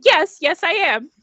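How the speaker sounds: high, loud, hurried agreement; flustered eager yes. Acted. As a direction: answer too keenly, caught off guard. Mother answers the attendant eagerly.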